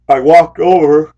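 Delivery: words stretched through a real yawn. Real, not acted. Driver yawning mid-story, words pulled long by a yawn. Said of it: "I don't know why I'm yawning".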